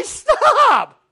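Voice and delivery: high, loud drawn-out yell; crying out. Retold or imitated. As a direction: imitate a desperate shout to halt. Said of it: "To raise your voice and cry out".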